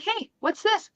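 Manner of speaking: high, sing-song mock-innocent question. Mother finding the hidden mouse, high mock-innocent question. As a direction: play dumb to catch him out.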